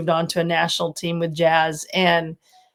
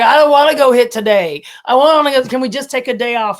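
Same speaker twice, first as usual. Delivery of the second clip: whiny complaint; 5 semitones higher, 4 dB louder than usual. Retold or imitated. Mother imitating her own complaint.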